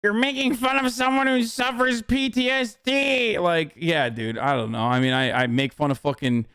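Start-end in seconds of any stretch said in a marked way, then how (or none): 0.0-3.4 high-pitched mocking critic voice, sustained throughout; mock outrage. Streamer parroting critics about PTSD, high mock outrage. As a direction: mimic a scandalized critic in a high voice.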